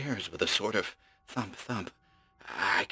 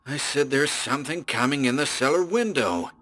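higher, tight with fear; almost shrill; 6 semitones higher than usual. Acted. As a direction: voice rising toward panic. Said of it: "almost shrill".

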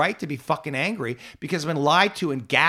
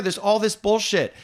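ordinary talk

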